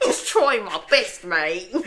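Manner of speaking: drawn-out teasing Australian imitation; slow, hammed-up Australian; mock Australian accent. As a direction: parody a botched British accent as Australian. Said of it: "what do you call Australian?".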